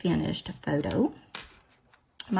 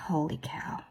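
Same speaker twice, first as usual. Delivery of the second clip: 1 whispered aside; 4 semitones lower, 7 dB quieter than usual. Real, not acted. Whispers in exasperation.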